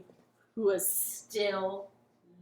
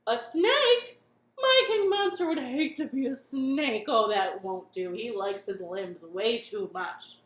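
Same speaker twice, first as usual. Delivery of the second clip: startled monster chef; 8 dB louder than usual. Acted. Monster chef shocked by the snake's warning. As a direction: yelp it out, shocked.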